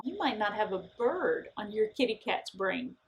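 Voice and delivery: ordinary talk, nothing marked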